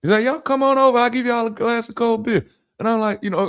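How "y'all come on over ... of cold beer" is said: very high imitation of a lady calling; inviting lady. Lady invites two men over for drinks, high mock call.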